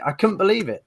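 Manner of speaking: ordinary talk, nothing marked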